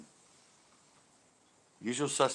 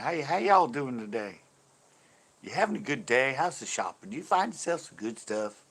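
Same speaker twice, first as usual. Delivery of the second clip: louder, put-on Texan shop greeting; Texas accent; 3 semitones higher, 7 dB louder than usual. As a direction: play a folksy Texas cashier working the customers. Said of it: "I went to Texas".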